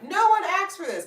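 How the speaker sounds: high, loud dismissal; dismissive. Retold or imitated. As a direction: scoff at it. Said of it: "they're like".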